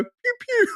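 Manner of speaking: very high little zaps; imitated laser noise. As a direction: squeak out a Space Invader "pew pew". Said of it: "I go".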